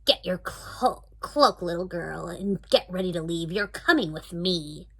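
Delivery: put-on bossy witch ordering the girl out.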